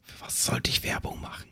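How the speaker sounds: sehr leises, stimmloses Flüstern; flüstere es wie beim lauten Nachdenken; geflüstert. Real, not acted. Grübelt leise über die Werbung. (geflüstert, kaum hörbar)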